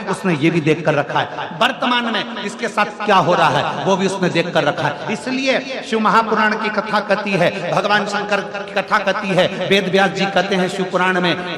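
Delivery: loud, driving sermon delivery; full-force preaching. Acted. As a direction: preach it out to the whole crowd.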